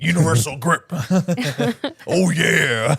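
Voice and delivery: raspy wrestler growl, laughing; Randy Savage. Pretend. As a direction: ham it up like a wrestler. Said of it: "Randy Savage-esque".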